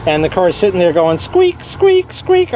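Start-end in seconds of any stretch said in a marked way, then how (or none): 1.2-2.5 high squeaking imitation of a car belt; high-pitched mock squeak noises; squeaky belt imitation. Car sitting there going.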